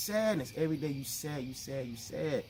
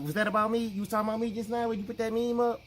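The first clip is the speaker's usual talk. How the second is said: paranoid friend; 9 semitones higher, 4 dB louder, faster than usual. Retold or imitated. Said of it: "somebody inbox you".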